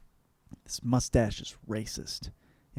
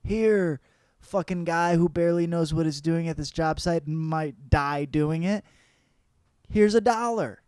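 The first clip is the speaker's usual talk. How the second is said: higher, louder blue-collar rant; boss voice; 4 semitones higher, 3 dB louder than usual. Retold or imitated. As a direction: hammer it out like a fed-up working man. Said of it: "digitally go".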